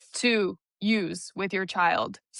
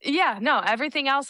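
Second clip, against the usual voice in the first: usual speaking voice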